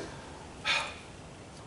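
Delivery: long, soft acted sigh; mother's sigh. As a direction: let out a long, breathy mom sigh. Mother's sigh before her lament.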